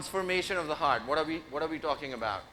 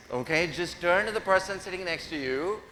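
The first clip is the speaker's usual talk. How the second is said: ordinary talk, nothing marked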